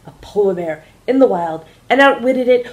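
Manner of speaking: first officer introducing the captain, rising to a grand, loud finish; airline announcer, building. Acted. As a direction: build the introduction to a big flourish. Douglas announcing to passengers.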